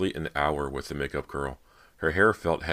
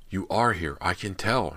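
excited whisper; 4 semitones higher, 4 dB louder than usual. A narrator's reading. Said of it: "she whispered excitedly".

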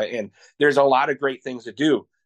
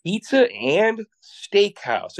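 slow, drawn-out "Pizza and steakhouse", pitched up like a baffled question; slow, higher, doubtful echo of "Pizza and steakhouse"; puzzled repeat; 7 semitones higher, much slower than usual. Retold or imitated. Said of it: "they're like".

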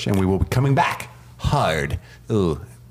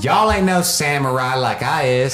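higher, louder hammed-up Southern Alabama samurai boast; hammed-up Southern accent; 6 semitones higher, 3 dB louder than usual. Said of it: "a Southern Alabama accent".

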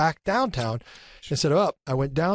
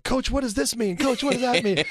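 higher, sing-song teasing; mocking schoolboys; 9 semitones higher than usual. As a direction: needle the coach like bratty seventh graders. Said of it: "So we'd be like".

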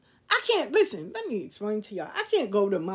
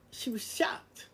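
hushed whisper; 9 dB quieter, slower than usual. Real, not acted. Hushed aside in the story.

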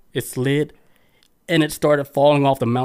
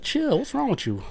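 much higher, put-on voice of the annoyed sister; mock sister voice; 11 semitones higher, 4 dB quieter than usual. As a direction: play the sister brushing off a pat-down. Voicing the sister at the door.

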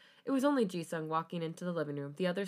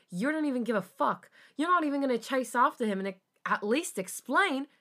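raised, indignant reproach; upset accusation; 7 semitones higher, 5 dB louder than usual. A narrator's reading. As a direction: accuse him with pitch climbing. Felix accuses Jisung in quarrel.

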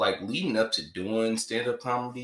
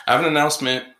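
loud, high proclaiming; host playing with ways to announce news; loud announcement; 8 semitones higher, 12 dB louder, much faster than usual. Shown as a demonstration. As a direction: proclaim it to the room. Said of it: "We have an announcement".

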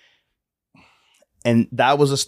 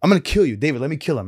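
mock angry threat; 6 semitones higher, faster than usual. Angry man threatening to kill.

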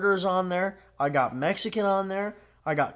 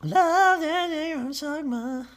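mocking slogan voice; 12 semitones higher, slower than usual.

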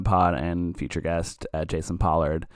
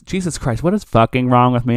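self-annoyed outburst; 3 semitones higher, 7 dB louder than usual. Real, not acted. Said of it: "I'm not cutting any of this".

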